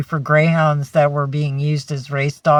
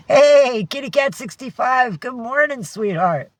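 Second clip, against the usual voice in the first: excited greeting; 7 semitones higher than usual. Real, not acted.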